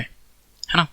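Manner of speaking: usual speaking voice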